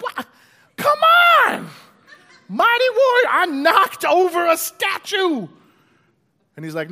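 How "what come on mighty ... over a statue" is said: much higher and louder, outraged protest; hammed-up whining yell, slowing at the end; exasperated shout. Gideon protests God wants more.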